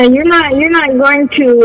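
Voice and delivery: usual speaking voice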